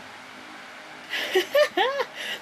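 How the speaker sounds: high, airy giggling; amused little laugh at a pet; light high-pitched giggle. Real, not acted.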